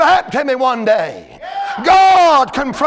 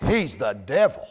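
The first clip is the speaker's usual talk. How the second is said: much lower, slow and weighty; low dramatic preaching; 3 semitones lower, slower than usual. Acted. Preacher naming the devil to the congregation, low and slow. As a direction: lean in low and heavy.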